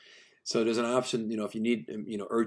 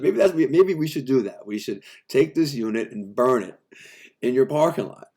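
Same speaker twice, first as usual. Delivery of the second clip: louder, sarcastic rant; loud mocking threat; 4 semitones higher, 8 dB louder than usual. Contractor threatening to burn the unit in their lot, loud sarcasm. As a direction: hammer home the burn-it threat. Heated rant retold to tech.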